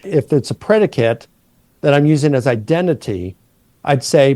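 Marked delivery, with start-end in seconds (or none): none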